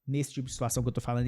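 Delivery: ordinary delivery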